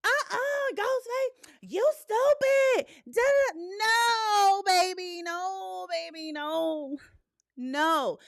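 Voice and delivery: high, loud, shrieking at the screen; mock screaming moviegoer. Host imitating loud theater talkers yelling at Ghostface. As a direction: scream at Ghostface like a rowdy viewer. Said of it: "I'm cool with reacting, but".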